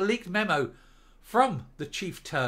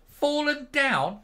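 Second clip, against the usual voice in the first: high, slow, louder disbelief at fallen down; incredulous echo; 11 semitones higher, 5 dB louder, much slower than usual. Acted. Ranting vlogger echoing the news, scoffing in disbelief.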